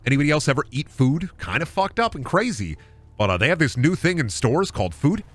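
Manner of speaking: ordinary delivery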